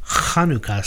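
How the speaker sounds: "Hanukkah" with a long throaty rasp on the first sound; "Hanukkah" opening on an exaggerated Hebrew ch; guttural Hebrew pronunciation. Shown as a demonstration. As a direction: lean hard on the throat-clearing start of "Hanukkah". Naming song with guttural Hebrew pronunciation.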